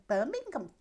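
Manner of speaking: high-pitched mock Brummie reply; Brummie accent. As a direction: imitate a Brummie answering innocently in passing. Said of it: "I don't like the way Brummies speak".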